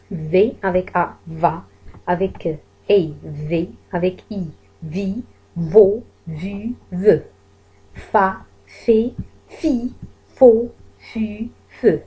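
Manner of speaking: va vi vo vu ve, then fa fe fi fo fu, each sounded out; syllable drill. Shown as a demonstration. Teacher contrasting V and F syllables for Arabic-speaking learners. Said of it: "teacher drills V and F syllables".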